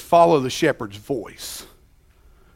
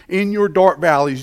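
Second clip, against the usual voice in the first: normal talking voice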